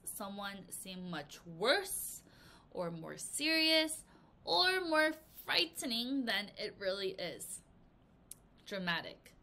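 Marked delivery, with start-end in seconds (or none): 0.0-7.6 slow down and weight each phrase; slow emphatic definition. Shown as a demonstration. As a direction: stretch out much worse, more serious. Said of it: "it means".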